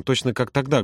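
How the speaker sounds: ordinary delivery, nothing marked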